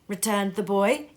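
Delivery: usual speaking voice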